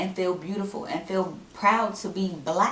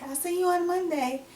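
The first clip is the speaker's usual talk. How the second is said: high, sing-song fake-friendly "see you Monday"; chirpy boss voice; 12 semitones higher than usual. Mocking the boss who fired her, breezy and high. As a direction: mimic the boss's breezy goodbye. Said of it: "when you was like".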